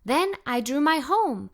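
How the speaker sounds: usual speaking voice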